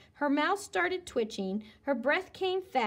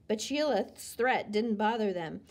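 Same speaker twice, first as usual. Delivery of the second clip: ordinary delivery, nothing marked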